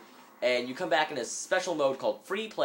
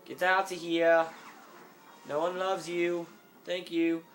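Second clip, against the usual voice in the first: mock angry yell at droids; 7 semitones higher than usual. Gamer telling off battle droids, mock angry. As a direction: scold the droids like a fed-up parent.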